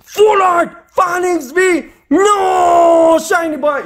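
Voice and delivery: cris aigus d'excitation. Real, not acted. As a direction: crie chaque nom de carte, ravi. Tire une carte Full Art. (excité, crie devant la Full Art)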